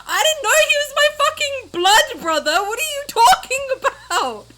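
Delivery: high, loud, laughing outrage; shocked yell. Real, not acted. Shocked yelling at twist.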